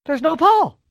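sudden high disbelief; surprised. Real, not acted. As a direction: voice jumps up in shock. Partner suddenly missing in woods.

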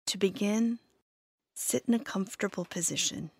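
calm, measured meditation voice with long pauses; guided meditation. Acted. Therapist leading listeners through loving kindness, calm guided meditation. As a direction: guide them gently, leaving space after each phrase.

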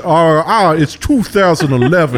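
flustered old Southern belle, hammed-up. Mocking actors playing Southern belles, flustered Southern belle accent. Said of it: "an old Southern belle".

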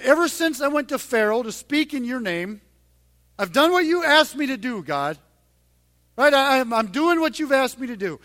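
pained complaint. Retold or imitated. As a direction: plead your case, hurt and confused. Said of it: "full of, like, pain and confusion".

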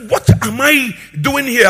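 high, loud self-questioning; preacher dramatizing a sudden wake-up cry; prodigal son's realization. Acted. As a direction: act out a startled coming to his senses. Acts son coming to his senses.

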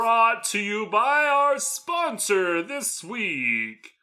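very slow, stretched-out ad announcer; mock-grand sponsor plug, word by word; erratic sponsor read. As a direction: drag out each word with odd swells and pauses. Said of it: "raising, lowering, raising, lowering volume".

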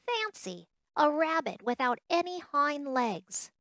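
high-pitched incredulous call. Acted. Wild rabbit makes fun of the legless toy, calling out. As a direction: call it out in mock amazement. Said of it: "he called out".

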